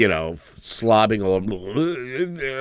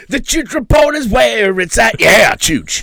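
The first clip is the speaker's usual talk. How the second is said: excited shout; 13 semitones higher, 9 dB louder than usual. Acted. Hyped response shout.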